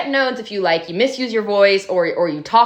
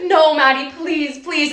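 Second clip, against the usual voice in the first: mock begging; 7 semitones higher, slower than usual. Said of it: "he's sobbing".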